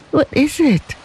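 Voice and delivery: high, anxious repeat at full voice; mother pleading with the officers, anxious and quavering. A narrator's reading. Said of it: "quavered Lei Chu".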